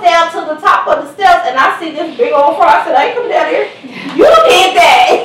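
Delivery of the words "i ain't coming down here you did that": laughing while refusing, louder; laughing refusal. Retold or imitated. Mom laughing at her son, refusing to come down.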